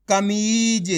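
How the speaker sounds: कमीज with the ee held long; drawn-out reading. Shown as a demonstration. Teacher joins the letters into a word, drawn-out कमीज. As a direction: stretch the मी in कमीज. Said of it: "आपको खींच के पढ़ना है".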